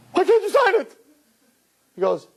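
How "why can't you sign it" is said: very high-pitched mock dismay; high, hammed-up disbelief; shocked protest. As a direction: imitate a stunned girlfriend's shriek. Shocked girlfriend confronts him.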